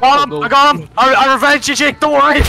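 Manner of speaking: high, loud victory shout; elated shout after avenging Jake; excited triumph. Real, not acted. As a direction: crow over the kill for your friend. Avenging fallen teammate.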